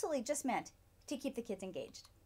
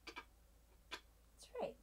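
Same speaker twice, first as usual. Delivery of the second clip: whispered praise; 14 dB quieter than usual. Acted. Praise after kids repeat.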